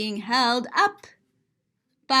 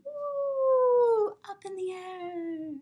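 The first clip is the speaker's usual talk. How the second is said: swooping high woo, then fading; high playful woo trailing off; rising woo; 8 semitones higher, 3 dB quieter, much slower than usual. Acted. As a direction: float the woo up like a balloon. Balloon rising, voice soars.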